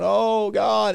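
high, hammed-up despairing moan; mock freaking out. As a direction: imitate someone helplessly panicking. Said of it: "they're just absolutely freaking out".

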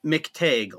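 "McTague" with a long A, like "tayg". Shown as a demonstration. Guest telling host how to say his name, "McTague" with long A. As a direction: say "McTague" clearly, second syllable rhyming with vague. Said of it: "long A".

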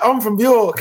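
high, imitated northern English accent. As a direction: mimic someone from York in an English accent. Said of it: "when English people come".

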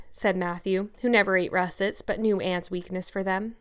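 ordinary delivery

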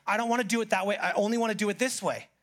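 lightly voiced petulant excuse; stubborn complaint. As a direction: imitate a grudging churchgoer. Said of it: "you're going".

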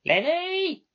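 high, loud yell over her shoulder; bellowing. Acted. Controller calls driver from back room, bellowing. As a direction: bellow the name across the office. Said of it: "bellowed over her shoulder".

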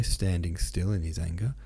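ordinary delivery

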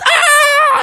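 high, loud zapped cry; acted pain scream. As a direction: cry out as if shocked. Struck on the arm.

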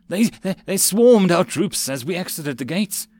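panicked, stumbling over words; urgent panting report. A narrator's reading. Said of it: "A breathless messenger".

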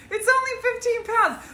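high, breezy mock confidence. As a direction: toss it off like it's nothing. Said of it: "You're just like".

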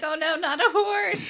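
high, loud wail through laughter; hammed-up distress cracking into a laugh; mock dismay. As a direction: lament in laughing horror. Co-host acts comic dismay, laughing.

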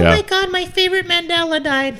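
very high squealing fan voice; mock fangirl. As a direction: gush like an upset fan. Mocking online fan posts.

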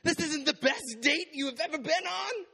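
high, loud squeal of offended disbelief; mock the girlfriend's high indignant shriek; girlfriend's outraged voice. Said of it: "And she goes".

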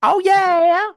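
high, loud drawled "Oh, yeah"; southern drawl. Retold or imitated. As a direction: imitate a thick southern drawl. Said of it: "That's how she sounds".

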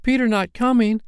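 shocked disbelief. A narrator's reading. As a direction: gasp it out in disbelief. Boys shocked at news.

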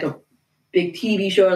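normal talking voice

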